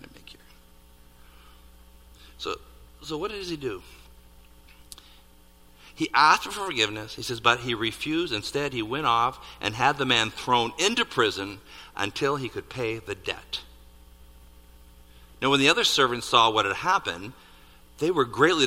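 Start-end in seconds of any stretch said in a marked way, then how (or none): none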